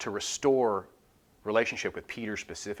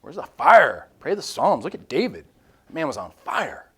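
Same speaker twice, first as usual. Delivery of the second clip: louder rising challenge, bursting on the fire; Jesus challenging; 4 semitones higher, 7 dB louder than usual. Retold or imitated. Jesus calls out a lukewarm praying believer, loud and challenging. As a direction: voice Jesus shaking a sleepy believer awake. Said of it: "Jesus is like".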